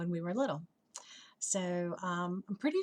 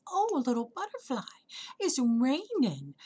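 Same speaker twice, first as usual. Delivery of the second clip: sympathetic flower; 5 semitones higher, 4 dB louder than usual. Acted. Hydrangea to a rained-on butterfly, high sympathetic coo. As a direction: coo it with big swooping concern.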